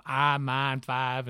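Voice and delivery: Southern drawl on I, mine, five, vowels flattened to ah; Southern accent. Shown as a demonstration. Man from Memphis showing his Southern accent. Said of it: "Southerners go".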